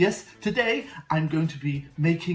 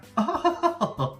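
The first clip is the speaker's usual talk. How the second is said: zany giggle; 11 semitones higher, 3 dB louder than usual. Acted. Host's character laugh.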